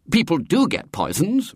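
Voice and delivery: high, indignant insistence. A narrator's reading. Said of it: "Robert said indignantly".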